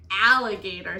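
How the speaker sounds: very loud, astonished repeat; loud incredulous echo. A narrator's reading. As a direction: blurt the word back in disbelief.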